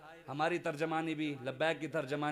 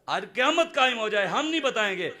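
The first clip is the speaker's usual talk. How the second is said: louder and higher, refusing; defiant loud repeat; 5 semitones higher, 7 dB louder than usual. Retold or imitated. Zahir refusing to say, now loud and defiant. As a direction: insist it, raising the voice. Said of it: "فرمایا".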